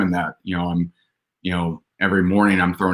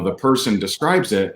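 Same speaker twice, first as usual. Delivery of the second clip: normal talking voice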